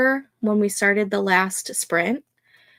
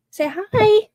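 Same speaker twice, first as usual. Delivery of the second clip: much higher, louder sing-song "say hi"; high coaxing; 12 semitones higher, 6 dB louder than usual. Real, not acted. To her dog on stream, high and coaxing. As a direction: coax the dog to say hi brightly.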